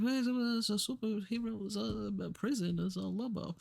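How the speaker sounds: slurred, mumbled rock star imitation; British mumble. Prisoner greeting Lobo in his cell, vaguely British mumble. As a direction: mumble vaguely British, words running into each other. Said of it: "he, like, mumbles".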